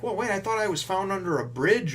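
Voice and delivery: high-pitched imitation of a surprised girl; retold puzzled protest. Retold child questions her origin story.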